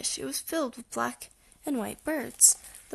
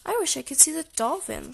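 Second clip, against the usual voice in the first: lightly voiced little sister, pitched higher; wishing Sister; 4 semitones higher, 4 dB louder than usual. A narrator's reading. Sister echoes her brother's wish, lightly voiced and higher. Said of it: "said Sister".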